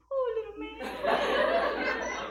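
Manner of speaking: put-on high, slow, tiny child's voice; cute little girl voice. Mimicking the little girl in the elevator, high and slow. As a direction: coo it like a sweet five-year-old. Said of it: "I'm just like".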